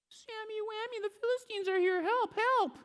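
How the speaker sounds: very high mock falsetto cry for help; hammed-up squeaky woman shouting warning; Delilah panic. Delilah cries Philistines arrived.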